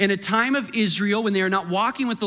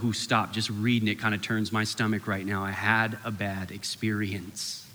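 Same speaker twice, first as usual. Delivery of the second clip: queasy disgust; 10 semitones lower, 6 dB quieter than usual. Retold or imitated. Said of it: "Some of you are like".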